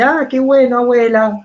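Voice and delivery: light, high, humoring tone; patient grandson. Acted. Speaker voicing the kind grandson humoring his grandma: lightly bright.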